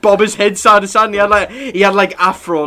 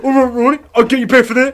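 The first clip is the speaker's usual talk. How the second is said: higher, louder mock Southampton accent, amused; imitated Southampton teacher; 7 semitones higher, 4 dB louder, faster than usual. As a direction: play the teacher retorting in broad Southampton. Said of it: "he turned around one time and went".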